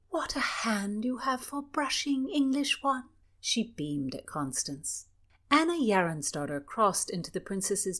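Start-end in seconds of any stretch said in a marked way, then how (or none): 0.1-3.0 high, lightly voiced young princess; a hint of a sweet royal girl. Princess Cecilia speaking.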